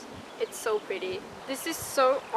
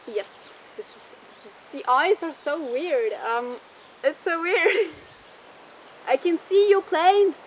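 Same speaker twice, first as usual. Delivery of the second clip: muffled through full head mask; speaking inside a costume head; 4 dB louder than usual. Real, not acted. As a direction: voice enclosed inside mascot head. Seen 4: Full mascot head covers speaker's head throughout; R frames show it off.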